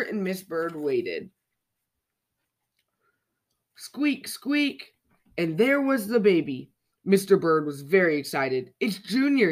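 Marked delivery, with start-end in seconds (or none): 3.7-4.9 put-on high little baby-bird squeaks; tiny squeaks. Chick in the egg squeaking to get out, high squeaks. 8.8-9.5 higher, louder happy cry; excited shout. A narrator's reading. Father bird greeting his newborn, excited shout. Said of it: "he shouted".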